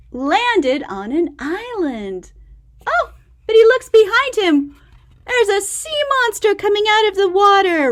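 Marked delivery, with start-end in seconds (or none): 2.9-7.9 fast, higher, louder alarm; alarmed excitement. A narrator's reading. Telling the child about the sea monster, alarmed.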